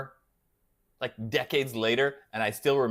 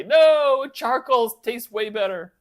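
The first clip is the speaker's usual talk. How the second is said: loud, higher mock objection; mock protest; 9 semitones higher, 8 dB louder, slower than usual. Streamer imitating viewers who object to propane, mock protest. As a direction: cry out like an outraged grill purist. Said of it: "I know people are gonna say".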